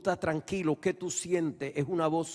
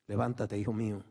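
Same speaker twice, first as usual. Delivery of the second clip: tender; 6 semitones lower, faster than usual. Acted. Voz suave de Dios habla.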